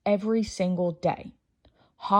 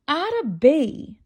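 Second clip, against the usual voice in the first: slow, higher, louder warning of the name; exasperated mother; 7 semitones higher, 4 dB louder, much slower than usual. A narrator's reading. Mother warning her chattering daughter, slow and exasperated. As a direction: stretch the name out as a firm warning.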